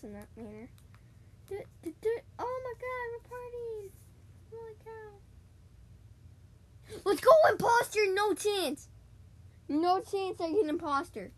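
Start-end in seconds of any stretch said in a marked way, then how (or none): 1.5-5.2 much higher, sing-song partying; giddy squeals. Real, not acted. Giddy celebrating in game. 7.0-11.3 much higher, loud cheering; thrilled yell. Real, not acted. Thrilled at getting imposter.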